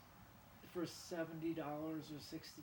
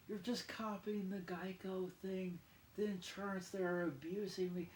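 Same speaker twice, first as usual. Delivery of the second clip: ordinary talk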